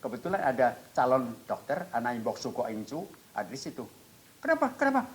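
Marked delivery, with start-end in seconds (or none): 4.4-5.0 very high, alarmed question; kaget bertanya. Retold or imitated. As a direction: imitate a startled helper rushing in. Menirukan orang datang bertanya cemas. (tiruan suara tinggi orang kaget)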